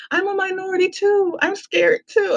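high-pitched mock plea of an officer; scared officer. Officer telling the driver he's scared, high mock plea.

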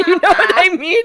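high laughing near tears. Real, not acted. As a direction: laugh through it.

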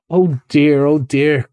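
mild cry of despair; understated exasperated despair. Acted. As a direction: lament your friend's obtuseness. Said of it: "cried the rat in despair".

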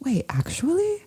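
breathless shocked whisper; surprised whisper. Real, not acted. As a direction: whisper it in disbelief. Shocked whisper at celebrity news.

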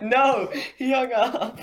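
high, loud words through laughter; real laughing outburst; laughing protest. Real, not acted. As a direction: crack up while protesting loudly. Laughing at prank call hang-up.

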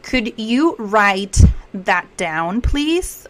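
drawn out, "please" lifting at the end; slow model request. Shown as a demonstration. Teacher models asking someone to write it, slowly. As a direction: model the polite question slowly.

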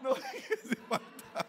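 high, slow, laugh-broken words; can barely get it out for laughing; laughing hard. Real, not acted. Preacher cracking up at his own slip of the tongue.

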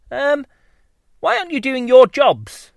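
high, puzzled little bee; young bee voice. Acted. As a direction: ask it as a curious small bee. Bumble the young bee asks.

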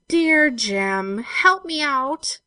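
loud, drawn-out, high-pitched plea; mock pleading. Girl mimicking Wilfred's letters to Jem, mock pleading. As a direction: recite a begging letter aloud with exaggeration. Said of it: "another letter for you in the same old strain".

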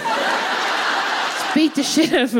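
laughing slow repeat. Real, not acted.

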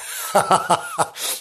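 high squealing giggle; high-pitched giggle fit; squealing laugh. Real, not acted.

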